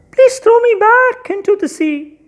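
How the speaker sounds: high, thin little fish voice; small fish voice. Acted. Fish begs the fisherman to let it go, high and small. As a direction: squeak it like a tiny pleading fish. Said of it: "Said the fish".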